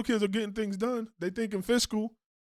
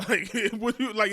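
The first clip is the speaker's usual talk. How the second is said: ordinary talk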